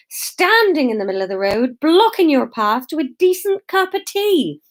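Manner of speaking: high, emphatic villager's scolding; indignant lecture. A narrator's reading. Villager correcting Mister Baxter about Jesus, indignant and high. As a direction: build pointedly to the last phrase.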